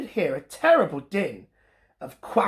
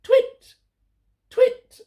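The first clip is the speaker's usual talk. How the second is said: very high, louder owl call; 6 dB louder than usual. Acted. Olive calling from high in her tree. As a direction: call up into the night sky. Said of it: "called out".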